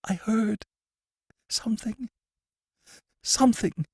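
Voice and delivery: high, halting, fearful words; haunted whisper of dread, broken by pauses; frightened prisoner hinting at what he heard; nervous fright. A narrator's reading. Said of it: "roved nervously".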